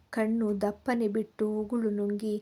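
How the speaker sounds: usual speaking voice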